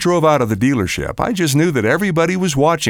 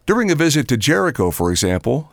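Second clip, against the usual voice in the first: usual speaking voice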